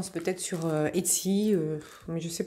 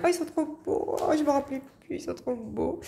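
higher, gushing delight; excited; 7 semitones higher, 3 dB quieter than usual. Real, not acted. Showing viewers the Christmas coasters, excited and higher. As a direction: coo over them, thrilled.

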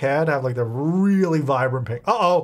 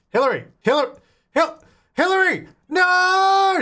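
calls her name, each time higher and louder until a shout; calling out; 4 dB louder than usual. Real, not acted. Calling after Hillary as she runs off, rising to a shout. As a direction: call her back, building to a yell.

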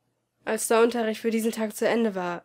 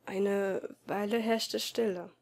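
plain reading voice

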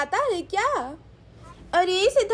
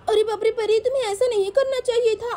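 usual speaking voice

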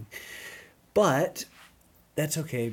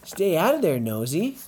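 sharp, louder warning to the dog; scolding the dog; 4 semitones higher, 6 dB louder than usual. Real, not acted. Owner scolds the nosy dog, raised sharp voice. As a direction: snap a quick no at a nosy dog.

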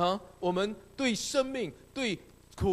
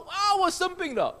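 mock whine; 10 semitones higher, 6 dB louder than usual. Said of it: "然后你说".

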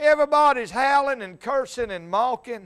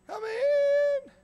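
very high, thin put-on call; calling from inside. Older householder answers the preacher's knock, calling in a high voice.

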